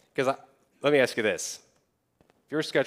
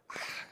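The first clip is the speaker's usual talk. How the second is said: soft, voiceless sigh; exasperated sigh; 13 dB quieter than usual. Acted. Preacher acting Moses balking at God, exasperated sigh.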